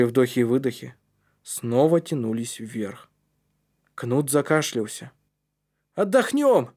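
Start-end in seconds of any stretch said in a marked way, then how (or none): 5.9-6.7 high, raised call. A narrator's reading. Калиб orders a rest in the chimney, calling out. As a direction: call it up the pipe. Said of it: "крикнул Калиб".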